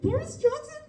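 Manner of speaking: very high, squeaky tearful wail, laughter underneath; mock sobbing. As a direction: wail the story down the phone, re-enacting hysterical crying. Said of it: "like, started sobbing".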